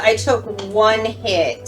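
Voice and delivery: ordinary talk, nothing marked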